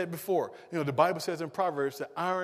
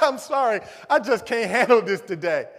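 mock exasperation; 4 semitones higher, 8 dB louder than usual. Acted exasperation with negative relative.

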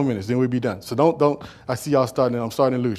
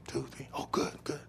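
whispered; 3 semitones lower, 18 dB quieter than usual. Acted. Acted grocery store chat.

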